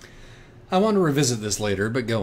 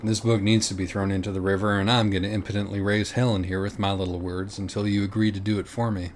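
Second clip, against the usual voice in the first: plain reading voice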